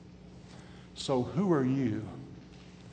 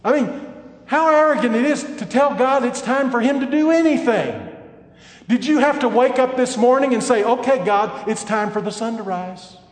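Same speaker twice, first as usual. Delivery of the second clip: loud, high preacher's cry; building sermon thunder; fiery preaching; 11 semitones higher, 9 dB louder than usual. Acted. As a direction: press the rebuke home from the pulpit. Preaching at full force.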